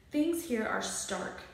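usual speaking voice

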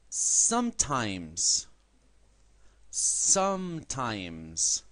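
Sometimes stretched very slowly, /amtaims/; very slow model word. Shown as a demonstration. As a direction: drill Sometimes slowly for repeating. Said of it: "Repitan después de mí".

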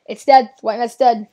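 loud, punched-out announcement; emphatic victory call. Real, not acted. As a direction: call out the kill forcefully.

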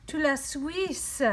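slow, lifted "La Suisse" with a French flourish; a playful French accent on "La Suisse". Acted. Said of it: "in my best French accent".

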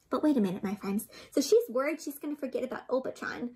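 ordinary delivery, nothing marked

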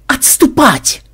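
high-pitched urgent yell; yelled command. A narrator's reading. Ksen ordering the players to retreat, in a high yell. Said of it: "заорал Ксен".

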